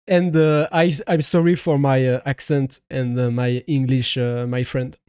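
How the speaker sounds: halting English with a thick French accent. Real, not acted. Streamer apologising to a foreign viewer, halting French-accented English. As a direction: stumble through English as a Frenchman. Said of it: "de mon accent anglais".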